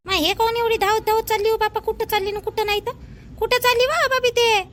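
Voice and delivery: high, loud worried questions; anxious calling out. Acted. Neighbour woman calling out asking where she runs. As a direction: call across to a neighbour in alarm.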